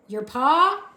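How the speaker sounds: loud shouted question; shouting. A narrator's reading. Soldier shouting his question to deaf Mig. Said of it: "shouted the soldier".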